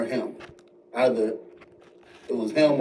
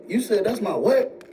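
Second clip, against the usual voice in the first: high-pitched, incredulous challenge; mock aggressive disbelief; 7 semitones higher than usual. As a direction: imitate an outraged son demanding answers. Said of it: "He got real aggressive".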